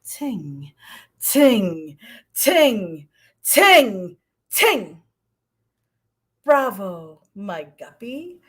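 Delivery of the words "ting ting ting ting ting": crescendo. Shown as a demonstration. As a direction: start barely there and grow to full voice. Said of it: "crescendo, pianissimo to fortissimo".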